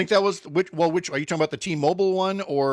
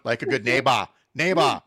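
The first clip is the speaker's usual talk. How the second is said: mock Austrian accent, "neighbor" ending in an open "bah"; Arnold Schwarzenegger; 3 semitones lower than usual. Quoting the insurance ad to the co-host, hammy Austrian accent. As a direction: drop the final R in an Austrian action-star accent. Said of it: "I like the Schwarzenegger one".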